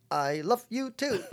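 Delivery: high, slow, lightly voiced old father proudly saying 'I love you too'; a hint of an elderly dad trying out new words; imitating dad. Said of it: "He goes".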